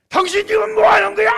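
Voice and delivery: very high, very loud scolding yell; general's wife yelling at her husband; wife's furious shout. Retold or imitated. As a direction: scream at her husband to stop. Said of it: "사모님이 화를 막 소리를 지르니까".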